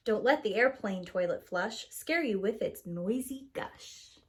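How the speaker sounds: ordinary delivery, nothing marked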